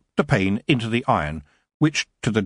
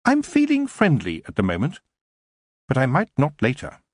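sweetly; 4 semitones higher than usual. A narrator's reading. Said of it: "She smiled sweetly and added".

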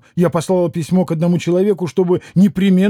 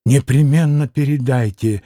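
frenzied; 3 semitones lower than usual. A narrator's reading. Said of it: "иступлённо, вся сотрясаясь, приказывала она".

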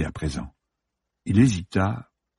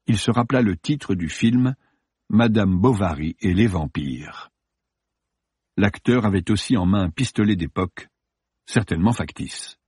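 ordinary delivery

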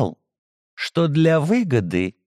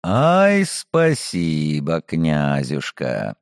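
sarcastic thanks; 8 semitones lower, much slower than usual. Acted. Hero mocks, drawn out thanks.

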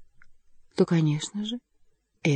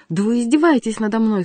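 raised, louder cry of outrage; indignant cry; 4 semitones higher, 6 dB louder than usual. A narrator's reading. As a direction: cry out at being mocked. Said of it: "вскричал, побледнев". (возмущённый вскрик)

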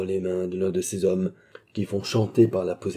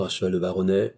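plain reading voice

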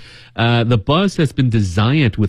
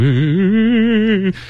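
high spooky ghost moan; ghost wail; 10 semitones higher than usual. Pretend. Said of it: "ghosts might be going".

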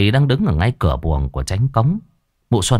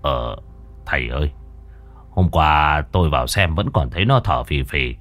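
low gruff official; 6 semitones lower than usual. A narrator's reading. The village chief asks the master, low and puzzled. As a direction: ask uneasily in a low voice. Cai Tổng Huỳnh asks.

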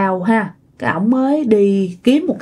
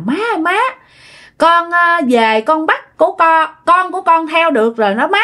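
put-on a lightly raised, pleased girl's voice; satisfied ghost voice; 7 semitones higher than usual. A narrator's reading. Daughter's ghost tells mother, pleased, in a dream. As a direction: sound smugly content calling mother. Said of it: "nói với cái giọng rất là mãn nguyện".

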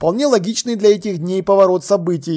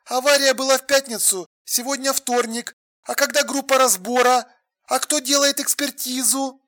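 higher, softer woman's voice; questioning woman on the phone; 6 semitones higher, 5 dB quieter than usual. A narrator's reading. Alla querying him about the accident, insistent. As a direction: question him in a high, quiet voice.

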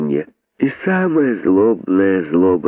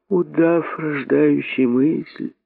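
higher, slower, softer mocking drawl; higher rabbit scoffing at the boa; mocking smirk; 7 semitones higher, 3 dB quieter, slower than usual. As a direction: sneer at the idea slowly. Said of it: "усмехнулся находчивый".

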